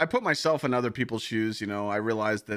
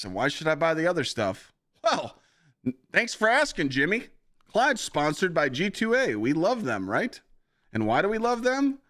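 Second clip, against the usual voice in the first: higher, bouncy pitchman voice; mock ad-read voice; 8 semitones higher, 3 dB louder than usual. Streamer hamming up a G2A sponsor read, higher pitchman voice. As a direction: hype it up like a cheesy commercial. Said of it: "I'm just talking like this".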